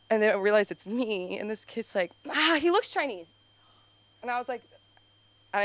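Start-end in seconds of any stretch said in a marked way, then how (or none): 2.3-3.3 lightly voiced kid. Kid's voice imitated.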